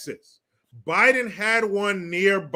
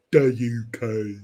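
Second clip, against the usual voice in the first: slow, low, put-on British accent; hammed-up British, pitched down and stretched; mock British accent; 5 semitones lower, slower than usual. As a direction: draw it out in a joking British accent. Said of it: "I just love to do that accent".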